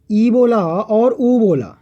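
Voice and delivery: ई and ऊ drawn out slowly and carefully; slow vowel demo. Shown as a demonstration. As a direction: say ई, then ऊ, slowly for the class. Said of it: "जैसे आपने ई".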